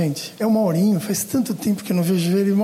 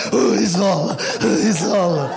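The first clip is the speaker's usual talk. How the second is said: imitando supersticioso; 4 semitones lower than usual. Retold or imitated. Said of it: "a gente vem aqui, ó".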